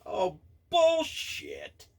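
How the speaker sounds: high, drawn-out disbelieving scoff; scoffing dismissal. Real, not acted. Ranting about Pepe Le Pew claims, in a stretched, disbelieving scoff. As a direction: wave it off as utter nonsense, stretched and incredulous.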